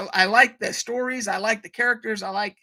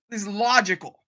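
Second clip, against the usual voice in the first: ordinary delivery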